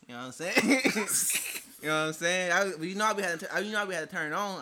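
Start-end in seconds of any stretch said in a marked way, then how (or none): none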